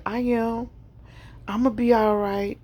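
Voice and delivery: put-on a sick mother trying to sound strong for her child; frail reassuring mother. Weak mother reassuring her daughter.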